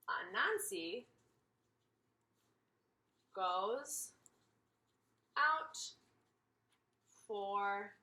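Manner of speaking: word by word, very slow and spaced out; slow dictation. Real, not acted. Teacher says each word slowly while writing the Venn diagram. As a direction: spell it out slowly as you write.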